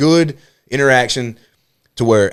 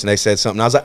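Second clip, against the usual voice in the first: ordinary talk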